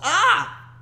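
high happy shriek; joyful cry. Real, not acted. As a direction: squeal with relief. Celebrating the win.

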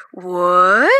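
drawn-out, rising puzzled what; puzzled listener's questioning what; puzzled reaction. Retold or imitated. People far off hearing the squeal, puzzled question.

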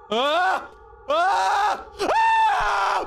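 high, rising screams of fright; startled screams. Real, not acted. Player meeting the tall ghost, high startled screaming. Jump scare in the game.

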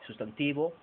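ordinary talk

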